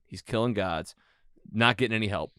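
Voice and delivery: ordinary talk, nothing marked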